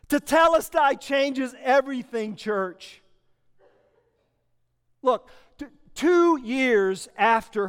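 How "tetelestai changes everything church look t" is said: ordinary delivery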